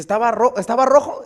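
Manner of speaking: sharp, high and loud question; the cop pounces, loudly suspicious; high, loud, startled demand; surprised officer. Retold or imitated. Police officer questions the pastor.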